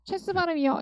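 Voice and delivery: ordinary talk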